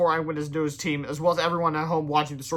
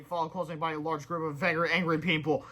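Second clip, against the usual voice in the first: plain reading voice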